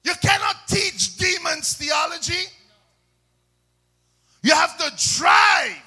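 high, loud pulpit shout; preaching at full force. Real, not acted. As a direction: proclaim it to the whole church at full volume.

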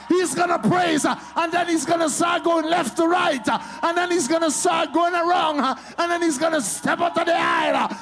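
loud high panicked devil voice. Retold or imitated. Preacher voices the devil panicking at a worshipper. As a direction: play the devil losing it. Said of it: "he gets scared because he's like".

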